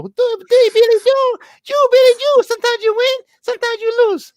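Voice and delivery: put-on very high, loud squeaky Brooklyn Puerto Rican accent; high-pitched Nuyorican Rosie Perez imitation; Rosie Perez impression. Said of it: "I love Rosie Perez 'cause that's the nineties".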